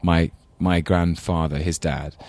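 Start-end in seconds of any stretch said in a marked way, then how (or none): none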